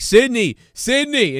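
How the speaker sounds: high, mock calling of her name; pushy reporter. Reporter interrupting the grieving girlfriend, high mock call. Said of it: "he was like".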